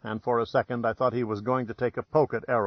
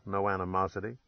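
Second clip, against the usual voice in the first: usual speaking voice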